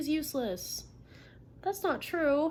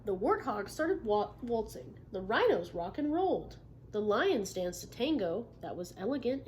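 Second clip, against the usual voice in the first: picture-book read-aloud; reading to children; 2 semitones lower, 4 dB quieter than usual. Acted. Teacher reading a story to the class.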